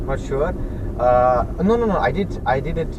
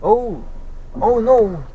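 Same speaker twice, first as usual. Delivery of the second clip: sudden high, loud dismay; a real startled oh no; surprised oh no; 9 semitones higher, 6 dB louder, much slower than usual. Real, not acted. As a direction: caught off guard as the boats leave. Sees the boats leaving.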